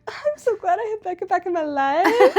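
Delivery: loud, high, hammed-up joy; playfully over-the-top happy outburst; gushing gladness. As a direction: squeal it with delighted gratitude. Acting out her joy at friend.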